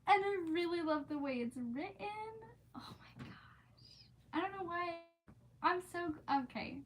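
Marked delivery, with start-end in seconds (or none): 2.8-4.1 breathy whispered oh my gosh, slow; awed whisper. Real, not acted. Gushing to her stream about the book, awed whisper. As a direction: whisper it like you can't believe it. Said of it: "awed whisper".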